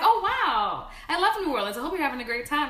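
high, loud, gushing delight; delighted LeVar. Retold or imitated. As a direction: gush about New Orleans. Voicing LeVar Burton delighted.

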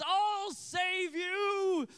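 high, loud, long-stretched rescue cry; mock heroic shout. Eight-year-old boy to girl in the tower, mock heroic shout. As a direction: imitate a boy playing the hero at full volume. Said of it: "steps to the side and says".

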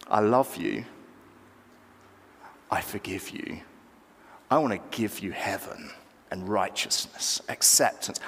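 low, soft, tender reassurance; tender God. Acted. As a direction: drop right down and offer forgiveness gently, with long pauses. God voiced offering love and forgiveness.

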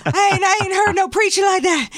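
mock awe of a swooning congregant; mock churchgoer. Mocking a church crowd.

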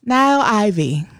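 ordinary talk, nothing marked

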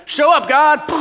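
high, loud call for God to show up; bossing God around, half mocking; mock demand. Demanding God act on cue.

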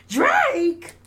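high, loud, incredulous shout. Retold or imitated. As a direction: yell the name in mock disbelief. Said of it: "in my [name] voice".

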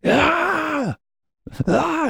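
very high, shrill mock war yell; Tony's yell. Tony Robbins on stage after the breakup, hammed-up yell. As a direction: let out a wild guru shriek. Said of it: "Tony's just like".

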